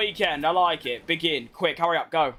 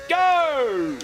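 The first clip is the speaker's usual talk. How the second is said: excited shout; 6 semitones higher, 3 dB louder than usual. Real, not acted. Urging himself through the lap.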